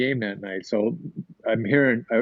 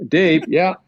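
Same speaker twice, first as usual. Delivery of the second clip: calling out; 6 semitones higher, 4 dB louder, slower than usual. Retold or imitated. Newman calling back, Dave answering.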